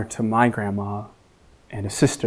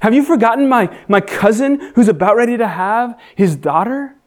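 raised, pleading questions to God; anguished prayer; 8 semitones higher than usual. Retold or imitated. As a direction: cry out to God in doubt. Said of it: "Questions like".

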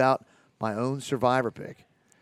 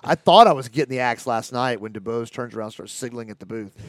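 ordinary talk, nothing marked

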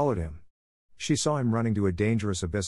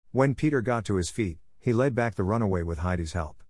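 ordinary delivery

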